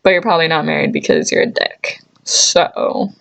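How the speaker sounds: normal talking voice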